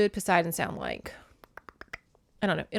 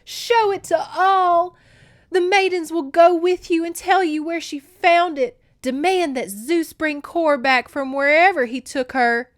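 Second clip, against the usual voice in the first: high, loud, urgent command; insistent, raised-voice urging; 9 semitones higher, 7 dB louder than usual. A narrator's reading. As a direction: press the demand hard, voice pitched up. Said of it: "she urged".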